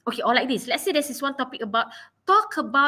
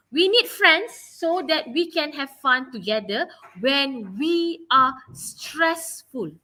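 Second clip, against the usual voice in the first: slow, word-by-word model sentence; measured and deliberate, slowing further towards the end; teacher's model rephrasing; 2 semitones higher, slower than usual. Shown as a demonstration. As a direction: stretch it out for students to follow. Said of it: "rephrase the sentence".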